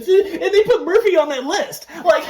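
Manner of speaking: high, laughing retelling. Real, not acted. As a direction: laugh your way through it. Laughing while retelling scene.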